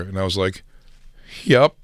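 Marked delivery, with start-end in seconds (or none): 1.4-1.7 higher, louder fake-chipper yep; mock cheerful brush-off; sarcastic agreement. To a comic praising the duo, fake cheerful agreement. Sarcastic reply to a clueless compliment.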